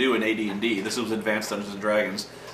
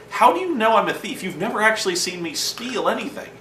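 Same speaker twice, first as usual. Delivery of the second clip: pitched way up, playing offended innocence; mock indignant protest; 10 semitones higher than usual. Thief player to party, mock indignant and high. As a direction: act wounded at the accusation. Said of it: "it's like".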